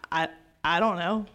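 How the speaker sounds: low, soft, halting answer; quiet, guilty stammer; sheepish child. Acted. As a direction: hesitate like a kid caught out. Child's sheepish answer to scolding parent.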